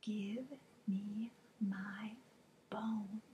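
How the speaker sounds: very slow, soft eerie whisper; spooky whisper. Acted. The bone's voice whispering to the teacher, slow and eerie. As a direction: breathe it like a ghost from the pocket. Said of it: "the teeny tiny voice whispered".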